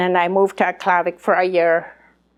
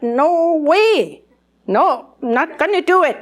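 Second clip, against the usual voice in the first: higher, louder flat refusal; emphatic refusal; 8 semitones higher, 4 dB louder, slower than usual. Retold or imitated. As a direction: push back hard against the request. Retold refusal to read aloud.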